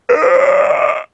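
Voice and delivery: loud voiceless groan of revulsion; disgusted "ugh". Acted. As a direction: mimic a crowd recoiling in disgust. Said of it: "everyone would be like".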